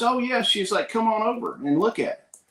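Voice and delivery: high-pitched quoted welcome; imitating friendly lady. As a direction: voice her easygoing invitation up high. Acting out the lady's cheerful invitation.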